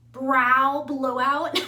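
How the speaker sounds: carefully sounded out. Real, not acted. Vlogger finally finds the name, slow and deliberate. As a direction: stretch out each syllable of Brow blowout. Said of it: "how do you say brow in English?".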